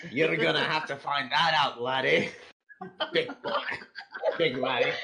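put-on Scottish ogre voice; Shrek. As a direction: play it as a hammed-up Scottish ogre. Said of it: "heard that in Shrek's voice".

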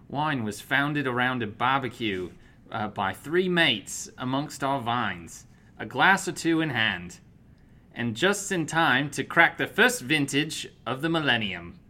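attempted Australian accent, careful reading. Co-host reading the wine label on request, Australian accent. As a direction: read it out in an amateur Australian accent. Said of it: "read this in an Australian accent".

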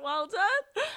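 very high, mocking mum. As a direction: sing it out like a sarcastic mum. Mum's sarcastic praise, retold.

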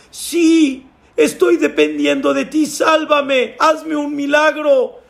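high, insistent begging; desperate pleading. Acted. Climber begs God for a miracle, high desperate plea. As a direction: beg urgently for a miracle.

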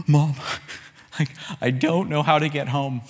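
mock gasping sobs between halting words; retold tearful child. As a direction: play a scared kid catching breath. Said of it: "I just burst into tears".

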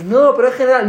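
high, loud evasive protest; protesting patient. Acted. Patient dodging the therapist's demand for a name, protesting.

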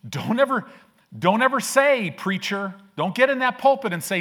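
preacher's fiery warning to the congregation; fervent exhortation. Real, not acted.

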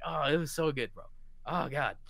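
usual speaking voice

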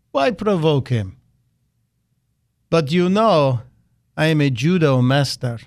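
bad Russian accent; hammed-up Russian accent, deliberate delivery; Vladimir Putin voice. Host quoting Putin the judo master, bad Russian accent. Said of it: "again in my bad Putin voice".

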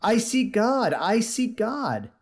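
high, slow cry of a man who sees God. Acted. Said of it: "and cried incessantly".

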